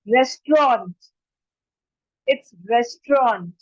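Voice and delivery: "restaurant" as RES-tront, two syllables; correct pronunciation. Shown as a demonstration. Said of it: "correct pronunciation क्या होता है". ("restaurant" को रेस्ट्रॉन्ट बोलें)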